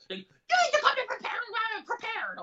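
hammed-up scolding, pitched very high; mock yell in a high put-on voice; Judge Judy yelling. Judge Judy berating a lady in court, imitated yelling. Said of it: "yelling at".

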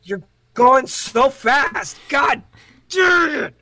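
yelling in playful exasperation; exasperated outburst. Real, not acted. At his friend racing through the plot, high frustrated shout.

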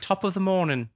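trying out a stock Irish greeting; mock Irish accent. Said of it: "That's Irish".

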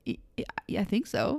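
soft, stammered mock hesitation; unsure reply. American unsettled by the question, soft stammering. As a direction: answer quietly, stumbling and doubtful. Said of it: "And, like".

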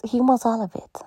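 soft whispered assurance. Real, not acted. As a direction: lean in and whisper it. Intimate point about God.

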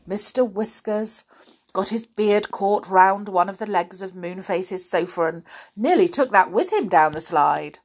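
ordinary delivery

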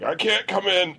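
high-pitched put-on sick voice; fake sick caller. Employee calling in sick to work, faking it. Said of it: "I would call in sick".

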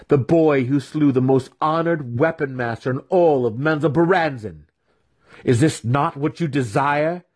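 high, grand, tempting build to a question; goading. A narrator's reading. Mentor quoting future admirers to his student, goading.

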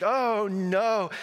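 put-on high child's anguished oh no. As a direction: mimic the boy's dramatic cry. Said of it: "he's like".

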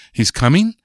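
"He's coming?" rising high on "coming"; question tune. Shown as a demonstration. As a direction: ask it, pitch climbing on "coming". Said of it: "That's a question".

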